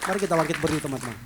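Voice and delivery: loud call to stand; rallying call. Real, not acted. Preacher calling the congregation to stand, loud rallying call. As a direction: rouse the congregation to their feet.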